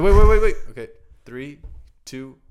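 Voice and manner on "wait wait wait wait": loud, high, urgent; excited. Real, not acted. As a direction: stop everyone, it's about to start. Rushing to start breath test.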